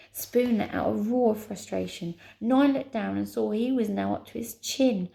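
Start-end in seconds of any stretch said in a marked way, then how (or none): none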